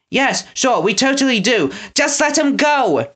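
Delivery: high, pressing, hurried plea; urgently. A narrator's reading. Winnie urging the henchmen to release him. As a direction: rush them to act now. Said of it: "Winnie said urgently".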